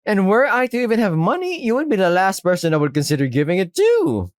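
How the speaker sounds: high, indignant shopkeeper; high-pitched affronted defiance; defiant refusal. Acted.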